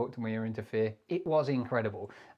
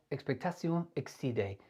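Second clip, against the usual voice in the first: made-up French, softer than usual; mock French accent; 3 dB quieter than usual. Shown as a demonstration. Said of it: "put anything in a French accent".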